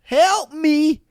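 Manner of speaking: high, drawn-out wailing cry for help; hammed-up imitation of a woman yelling out in distress; mock yelling. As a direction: wail it slowly, high-pitched, making fun of her. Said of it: "now she's yelling out".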